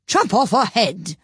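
put-on high, slow, gruff old duchess; rough, haughty duchess voice. A narrator's reading. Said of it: "said the Duchess".

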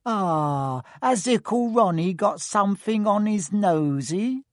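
put-on teasing high baby talk; mock baby talk. A narrator's reading. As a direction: coo at him like a baby. Twin teases Ron.

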